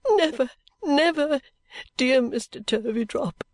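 high, breaking into a hushed tone; fervent young bride's assurance; devoted daughter-in-law. A narrator's reading. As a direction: protest tearfully and tenderly.

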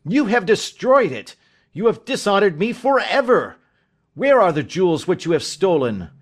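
high, loud, emphatic accusation; acted furious outburst; beside himself with rage. A narrator's reading. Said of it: "beside myself with rage".

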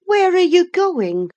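high, loud, imperious question; agitated high-pitched challenge; sharp demand. A narrator's reading. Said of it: "demanded Catherine".

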